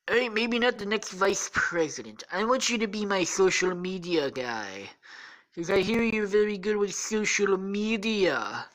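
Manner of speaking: high, sing-song salesman pitch; Donald Trump. Acted. Trump offers Gareth a job, hammy impression. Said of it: "says Trump".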